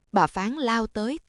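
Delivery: ordinary delivery, nothing marked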